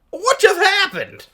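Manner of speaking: high, loud, drawn-out disbelief; exaggerated high-pitched surprise; wide-eyed shock. A narrator's reading. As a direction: squeal it slowly in mock astonishment. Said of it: "makes a wide-eye face at the camera".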